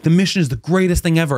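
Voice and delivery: high, gushing imitation of believers; mock enthusiasm. Everyone telling him about the mission, mock enthusiasm. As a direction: gush like a true believer, mockingly.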